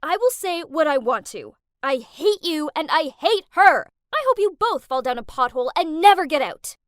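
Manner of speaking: loud, escalating angry shout; yelling. Acted. Angrier now, yelling curses at Kath down the phone. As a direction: let the hate boil over. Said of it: "so I yelled".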